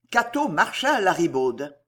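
plain reading voice

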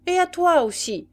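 high, loud call; sergeant's shout. A narrator's reading. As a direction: call it out to the woman. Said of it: "cria le sergent". (cri de sergent)

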